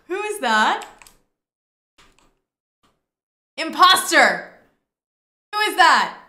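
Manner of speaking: loud alarmed cries at an intruder; alarmed shouting. Real, not acted. Stranger appears in the house.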